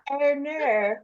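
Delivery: copycat mock Australian accent. Guest copying the host's bit, put-on Australian accent. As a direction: echo your friend's Australian phrase. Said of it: "as your Australian accent".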